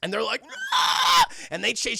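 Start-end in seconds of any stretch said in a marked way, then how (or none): 0.7-1.2 loud high-pitched mock roar; sudden piercing creature yell; imitated monster yell. Monsters chasing her through the house, mock roar.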